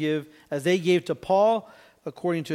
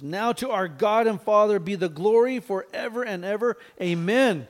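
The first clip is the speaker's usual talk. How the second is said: higher, louder burst of praise; joyful praise burst; 5 semitones higher, 5 dB louder than usual. Retold or imitated. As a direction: blurt out the praise with joy. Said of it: "just blurts out this praise".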